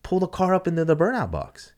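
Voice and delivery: lightly put-on voice giving an order; mock official's order. Track official ordering a driver into the burnout box, lightly mimicked.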